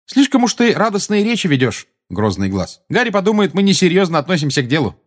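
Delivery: brisk, high-pitched sarcastic reproach; chiding Tonks. Acted. Tonks chides Moody for his gloomy talk, sarcastic.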